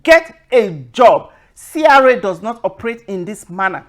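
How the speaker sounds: contemptuous scolding; scornful order. Retold or imitated. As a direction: bark it down the line at him. Scolding scammer again.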